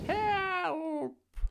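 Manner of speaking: very high, long-drawn wail; storybook witch squealing for help; cry for help. A narrator's reading. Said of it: "cried the witch".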